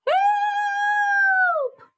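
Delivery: very high, loud drawn-out cry; cry for help. A narrator's reading. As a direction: call out for rescue from far away. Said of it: "came the cry again".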